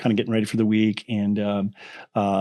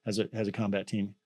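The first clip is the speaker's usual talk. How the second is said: much softer, letting it land; hushed, weighty; 2 semitones lower, 8 dB quieter than usual. Retold or imitated. Telling the suspicious veteran about his son, soft and weighty. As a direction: drop the voice to play the trump card. Revealing son is Green Beret.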